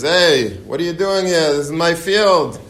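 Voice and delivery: high, loud mock tirade at the trespasser; shouting 'my field' at an intruder, half in jest; retold angry yell. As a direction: yell it like an irate orchard owner. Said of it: "He's, like, screaming at him".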